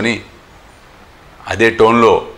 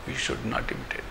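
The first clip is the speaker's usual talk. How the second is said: whispered, breathy echo of the lesson; whispered repeat; 16 dB quieter, much slower than usual. Shown as a demonstration. Teacher repeating the warning to devotees, whispered. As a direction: whisper it back to sink in. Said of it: "repeated lesson line".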